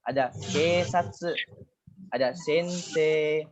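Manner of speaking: ordinary delivery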